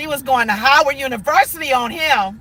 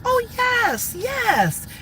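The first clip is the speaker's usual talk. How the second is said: polite phone voice, soft and quick; 5 semitones lower, 7 dB quieter than usual. Retold or imitated. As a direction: put on the proper office phone voice.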